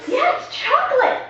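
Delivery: high, slow, loud and gleeful. Pretend. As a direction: savour the word like a treat. Drawn out gleeful.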